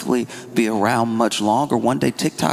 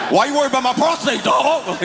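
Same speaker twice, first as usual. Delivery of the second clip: fast, shrieking high-pitched yell; freaked-out yell; 13 semitones higher than usual. Retold or imitated. Fifty-five-year-old friend spooked by a prostate call, panicked yell. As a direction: blurt it out in a panic. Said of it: "He freaking out".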